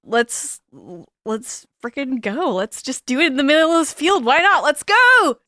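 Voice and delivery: acted giddy eagerness; building excitement. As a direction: talk yourself into it, climbing to a whoop. Acted eager rush to marry.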